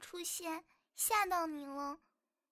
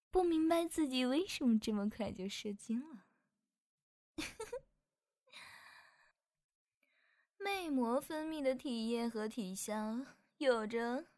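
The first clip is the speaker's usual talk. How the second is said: teasing succubus. Acted. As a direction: drop the shy act and sneer sweetly. 全都是骗你的.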